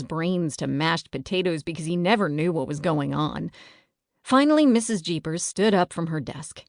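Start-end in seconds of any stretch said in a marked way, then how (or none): none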